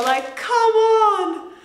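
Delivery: high, slow delighted exclaim; thrilled. Real, not acted. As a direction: gush with disbelief at a treat. Thrilled at washed arugula.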